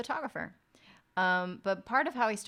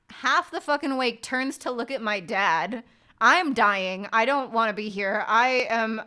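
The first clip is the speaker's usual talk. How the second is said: usual speaking voice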